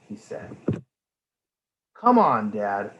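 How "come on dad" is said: higher, louder, slow whiny groan. A narrator's reading. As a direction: drag out the complaint like a bored child. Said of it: "groaned Amelia".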